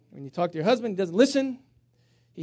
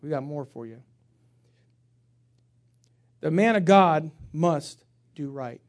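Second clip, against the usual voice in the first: ordinary talk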